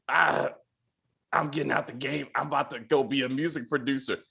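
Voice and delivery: low, quiet Batman-style voice; Batman-like Lulu. Acted. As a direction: impersonate Lulu with a deep superhero voice. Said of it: "that motherfucker sound like Batman".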